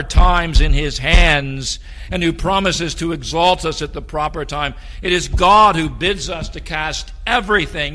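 loud, soaring pulpit voice; fiery preaching. Acted. Pastor exhorting the church about God's care: fiery preaching. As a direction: lift the congregation with full force.

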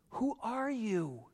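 a hushed, weighty who are you; dramatic hush. Shown as a demonstration. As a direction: let the question hang, quiet and grave. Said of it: "It's so much more dramatic than just saying".

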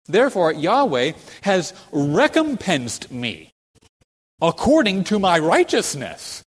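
loud, high, slow proclamation; bold declaiming. Acted. Preacher quoting David's claim to congregation, bold and loud. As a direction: declare it boldly, drawing out the words.

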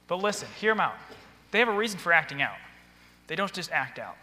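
usual speaking voice

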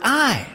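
single out the word "I" with a high, falling tone; emphatic I. Shown as a demonstration. Preacher naming the key word, emphatic "I". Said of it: "the emphasis there is on the word".

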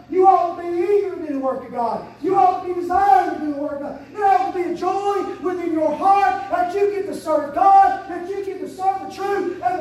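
loud preacher's shout, slowing; fiery preaching. Acted. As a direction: preach it to the rafters. Preaching with force.

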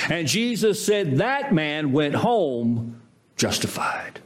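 ordinary delivery

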